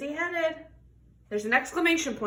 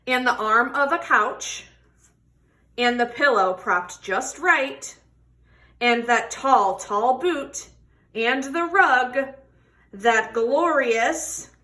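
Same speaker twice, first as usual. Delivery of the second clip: expressive picture-book read-aloud; reading to children; 6 dB louder than usual. Acted. Teacher reading a tortoise story to children, expressive. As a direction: tell the story warmly to a class.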